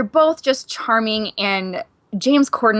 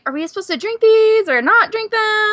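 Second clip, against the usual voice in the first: high, mock-bewildered questioning; unsure; 11 semitones higher, 4 dB louder than usual. Guest wonders about open bottles on the bar, unsure. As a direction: imitate nervous uncertainty, pitched right up. Retold confusion over prop beers.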